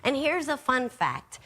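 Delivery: usual speaking voice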